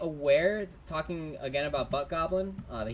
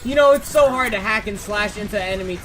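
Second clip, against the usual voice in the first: live play-by-play; 2 semitones higher, 7 dB louder than usual. Acted. Caster calling the fight to viewers, louder and building. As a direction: build the fight call toward the ult.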